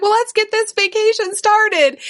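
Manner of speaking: put-on high, eager child voice; excited little girl. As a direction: bounce with a kid's excitement. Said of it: "she's like".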